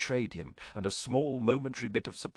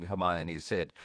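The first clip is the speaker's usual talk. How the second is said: ordinary delivery, nothing marked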